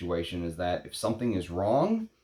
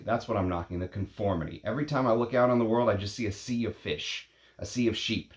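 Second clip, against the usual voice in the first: usual speaking voice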